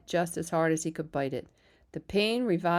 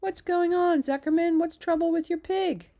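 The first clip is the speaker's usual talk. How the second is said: calling out; 12 semitones higher, 5 dB louder than usual. A narrator's reading. Loudspeaker calls out worried.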